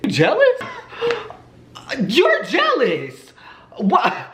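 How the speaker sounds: high, giggly taunt, drawn out; playful high-pitched goading through laughter; teasing him. Retold or imitated. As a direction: needle him with gleeful mock disbelief. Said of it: "how I'm kinda fucking with him".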